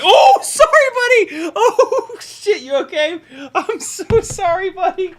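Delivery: high, loud, laughing sorry after a startle; yelp, then laugh through the apology; shocked laughing apology. Real, not acted. Apologising to the dog just hit in the face, high and laughing.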